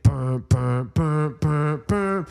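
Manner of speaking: punchy sung synth stabs; imitated record riff. Playing back the record a friend sent, mouthed riff. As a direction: voice the track's pulsing bum-bum hook.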